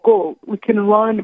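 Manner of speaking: ordinary talk, nothing marked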